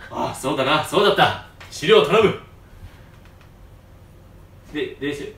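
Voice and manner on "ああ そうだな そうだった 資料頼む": Kogoro answering, louder. Retold or imitated.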